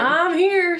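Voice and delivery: high playful ghost voice. Retold or imitated. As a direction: chirp it as a cheeky ghost. Voicing the ghost again.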